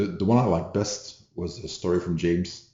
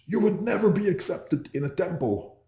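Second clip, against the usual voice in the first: high-pitched shrieked quote; an upset guy screaming at the teacher as he leaves; retold scream; 12 semitones higher than usual. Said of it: "and then screams".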